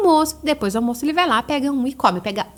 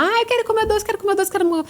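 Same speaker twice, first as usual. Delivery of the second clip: put-on higher whining child begging for candy; child whining for a sweet; whiny child; 9 semitones higher, faster than usual. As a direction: beg for candy like a small child. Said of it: "ele viu um doce lá".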